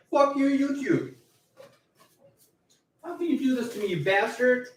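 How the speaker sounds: tiny, very high squeal of protest; mock falsetto outrage. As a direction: curse them in a squeaky falsetto. Mock outburst at YouTube takedown.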